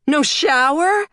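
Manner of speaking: high, loud incredulous question; horrified girl. A narrator's reading. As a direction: squeal it in disbelief at Dad. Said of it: "Again the horror".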